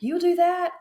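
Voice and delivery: squeaky high thrilled question; excited. Retold or imitated. Shop owner thrilled at the video offer, excited high squeal. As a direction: retell her delighted disbelief, way up high. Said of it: "because she was so excited".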